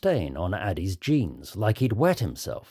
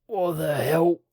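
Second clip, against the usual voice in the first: dazed character voice; 7 semitones higher than usual. Acted. Lee wakes up dazed after crash.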